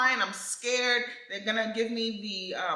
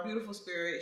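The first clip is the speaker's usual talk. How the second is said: usual speaking voice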